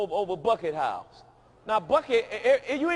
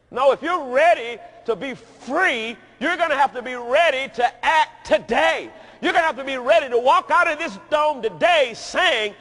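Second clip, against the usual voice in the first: louder, building preacher's exhortation; preaching at full force; 5 semitones higher, 8 dB louder than usual. Acted. Preacher urging the congregation, preaching at full force. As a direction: press the church hard toward action.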